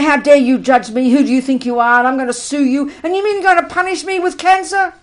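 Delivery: loud, indignant, hammed-up hysterics; mock hysterical outrage. Teacher mocking a patient who rages at the doctor, shouting. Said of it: "You don't get completely hysterical".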